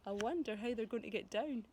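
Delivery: plain reading voice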